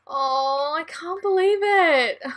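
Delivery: rising to a high, loud wail; dismayed disbelief. Real, not acted. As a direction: exclaim in drawn-out disbelief. Dismayed at the bad traits.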